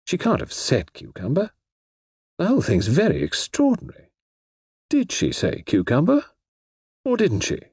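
befuddled author muttering; puzzled Eastwood. Acted. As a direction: wonder aloud, baffled. Eastwood musing aloud.

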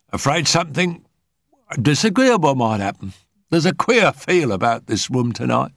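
high-pitched, chatty Mrs Thompson voice; high, talkative medium confiding unease; tired, uneasy medium. A narrator's reading. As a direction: pitch it high and complain of being worn out.

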